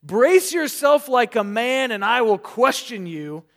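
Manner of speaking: God demanding Job answer, loud and commanding; commanding rebuke. Acted. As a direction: throw down the challenge like God to Job. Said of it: "a tongue lashing".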